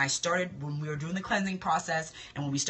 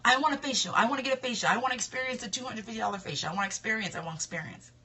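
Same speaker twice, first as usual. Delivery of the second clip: ordinary talk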